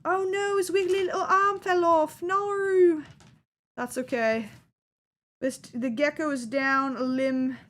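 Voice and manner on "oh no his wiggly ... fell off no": mock wail. Dice figure's arm breaks.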